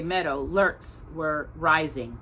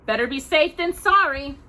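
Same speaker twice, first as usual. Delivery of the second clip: higher, firm caution; cautious warning; 8 semitones higher, 4 dB louder than usual. A narrator's reading. Pa warns Mister Scott, higher and firm. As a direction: warn him gently but firmly.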